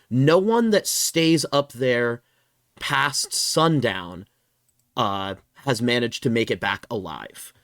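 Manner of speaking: slow, dropping ominous GM voice; ominous narration. Acted. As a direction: let the dread sink in slowly. Game master's ominous scene setting.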